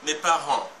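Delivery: mes parents with RENTS loud and long; stress on final syllable. Shown as a demonstration. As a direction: lean on the end of "parents". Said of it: "rang est la syllabe la plus forte".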